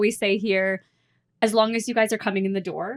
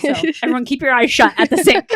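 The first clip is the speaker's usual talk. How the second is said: jokey loud warning; 6 semitones higher, 9 dB louder, faster than usual. Real, not acted. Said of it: "jokey loud advice to listeners".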